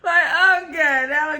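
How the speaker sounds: bursting out laughing, then talking through it. Real, not acted. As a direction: laugh it out loud and keep going. Laughing reaction to the clip.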